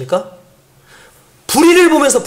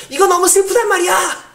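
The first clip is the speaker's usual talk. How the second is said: put-on high, tearful child's wail; crying child; 9 semitones higher than usual. Son crying to his parent that it's too sad. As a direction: cry it out like a hurt little boy. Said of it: "형민이 갑자기 울면서".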